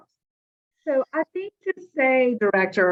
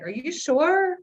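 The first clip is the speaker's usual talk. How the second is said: rising, higher-pitched check; doubtful question; 8 semitones higher, slower than usual. Retold or imitated. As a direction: retell a disbelieving double-check. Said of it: "And, like".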